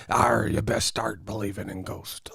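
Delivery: low, growly pirate impression; mocking gravelly pirate voice; pirate captain. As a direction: growl it like a salty sea captain.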